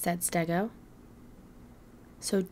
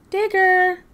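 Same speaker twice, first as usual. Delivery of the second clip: high, loud call across the house; 11 semitones higher, 6 dB louder than usual. Acted. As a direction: call him from another room. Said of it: "Mamasaur called".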